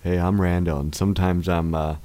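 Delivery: low, quiet, lightly southern nerdy guy; a hint of a deep-voiced southern guy; Randall impression. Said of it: "Can I see if I can do a Randall impression?".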